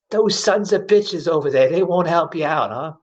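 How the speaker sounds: a hint of a kindly old lady leaning in with concern; little old lady voice.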